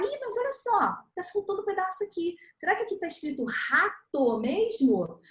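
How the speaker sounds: put-on teacher playfully pointing out a missing letter to the child; gently prompting teacher. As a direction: nudge the child to spot the gap. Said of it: "a gente vai falar".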